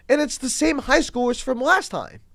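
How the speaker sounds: ordinary talk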